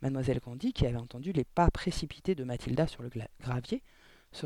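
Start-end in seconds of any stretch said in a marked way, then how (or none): none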